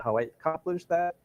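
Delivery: normal talking voice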